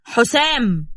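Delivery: sharp shouted call of حسام; father yelling at his son from behind; yelling a name. Acted. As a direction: bark the son's name from behind. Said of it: "سمع صوت أبوه من وراه بيزعق".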